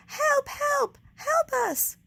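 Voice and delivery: high, loud cries from far off; faraway shouts. A narrator's reading. Someone far away crying for help, distant shouts. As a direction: keep calling for help from a distance. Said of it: "shouted a faraway voice".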